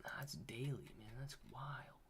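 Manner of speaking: a stunned whispered aside. Real, not acted. Streamer stunned by coin volume, whispering. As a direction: whisper it to yourself, voiceless.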